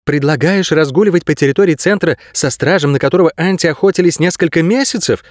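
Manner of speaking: mild outrage, pitch climbing at the end; indignant. A narrator's reading. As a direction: object in disbelief, without shouting. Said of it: "возмутилась Кейса".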